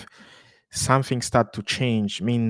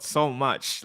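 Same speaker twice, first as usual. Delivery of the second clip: usual speaking voice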